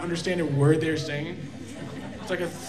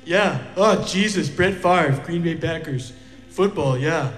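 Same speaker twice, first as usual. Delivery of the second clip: put-on Wisconsin accent. Shown as a demonstration. Local talking Packers football, Wisconsin accent. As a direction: do a chatty, caricatured Wisconsin accent. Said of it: "a Wisconsin accent".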